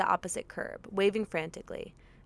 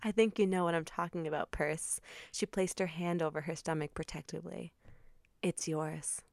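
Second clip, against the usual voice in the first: hushed dramatic; 3 semitones lower, 7 dB quieter than usual. Acted. Dramatic confession.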